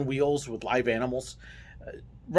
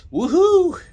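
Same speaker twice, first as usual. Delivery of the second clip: loud, high, whooped "woohoo"; mock cheer; 11 dB louder than usual. Driver celebrating seven miles an hour, mock cheer. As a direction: whoop with exaggerated thrill.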